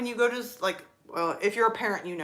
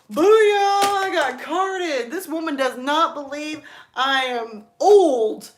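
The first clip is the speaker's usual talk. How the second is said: loud, high, drawn-out gloating; hammed-up celebration, stretching the words; mock triumphant excitement; 6 semitones higher, 7 dB louder, slower than usual. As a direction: crow it like a huge victory. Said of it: "I'm just over there excited".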